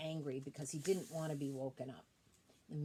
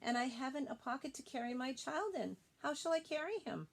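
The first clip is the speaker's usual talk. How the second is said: screaming up a tree; 7 semitones higher than usual. Acted. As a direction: call up to a deaf owl, higher and pushing. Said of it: "screamed at him".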